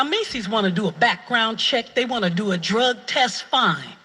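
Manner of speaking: usual speaking voice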